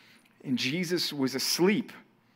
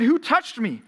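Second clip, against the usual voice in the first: sharp question; 7 semitones higher, 4 dB louder, slower than usual. Acted. Jesus asks in crowd.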